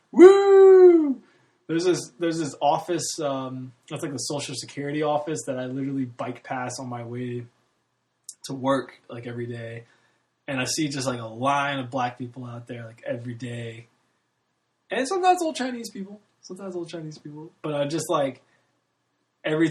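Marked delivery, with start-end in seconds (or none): 0.1-1.2 high, loud mock whoop; mock-cheering becoming a statistic; sarcastic cheer. Sarcastic cheer about being statistic. 14.9-16.2 first, higher take of the aside; raised aside. Real, not acted. Repeated phrase, first take raised. 16.4-17.5 softer, under the breath repeat; second, hushed take of the aside; quiet repeat. Real, not acted. Repeated phrase, second take dropped.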